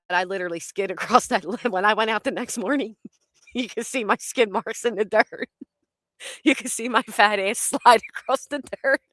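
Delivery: talking through laughter; real laughter. Real, not acted. Storyteller describing her skid marks in the dirt, laughing. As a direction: giggle through the telling.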